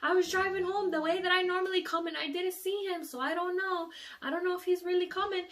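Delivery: an uncertain mom shrugging; mom's voice. Retold or imitated. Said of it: "And she was like".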